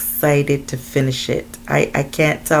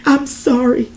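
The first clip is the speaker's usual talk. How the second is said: higher-pitched, desperate apology; pleading apology; 9 semitones higher, 3 dB louder than usual. A narrator's reading. Desiree begging Xander to stop, pleading apology. Victim begging her attacker.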